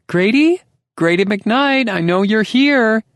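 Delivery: very high, loud shrill calling out; shrieking call. A narrator's reading. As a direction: shriek his name through the door. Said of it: "her voice a permanent shriek on chalkboard".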